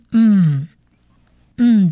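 嗯 as a closed nasal "m" hum; 嗯 held briefly as a pure nasal sound. Shown as a demonstration. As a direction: hum 嗯 through the nose as a final mem. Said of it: "它的发音就只发一个鼻音".

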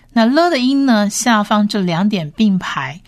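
normal talking voice

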